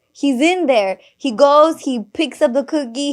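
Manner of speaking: ordinary delivery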